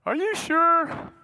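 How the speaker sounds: high, slow, disbelieving Are you sure; mock squeak of an old priest who can't believe it; incredulous squeak. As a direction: stretch it out in shocked disbelief. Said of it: "It's like".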